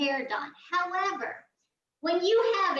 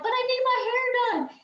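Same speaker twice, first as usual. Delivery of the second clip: whiny plea; 9 semitones higher than usual. Retold or imitated. Pleading with hairstylist.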